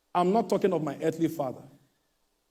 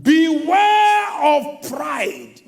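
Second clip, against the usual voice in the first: preached shout; 8 semitones higher, 5 dB louder, much slower than usual. Real, not acted. Preacher warning the congregation.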